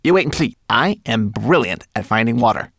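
high, proud little voice; small creature showing off; Twigleg, boastful little mannequin. A narrator's reading. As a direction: boast with emphatic swoops.

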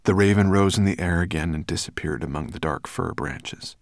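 ordinary delivery

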